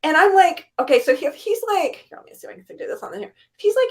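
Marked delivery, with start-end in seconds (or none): 2.1-3.3 mumbled quick aside. Retold or imitated. Gaiman arranging the photo.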